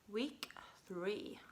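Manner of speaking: whispering. Acted. Whispered section title.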